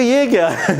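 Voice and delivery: amused disbelief with a laugh; laughing at himself. Retold or imitated. Watching his own first video, laughing in disbelief. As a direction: laugh through the question at the old video. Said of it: "میں نے کہا".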